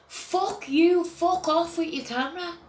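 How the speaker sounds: mock the man who swore at her; aggressive man. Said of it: "he smacks the camera and says".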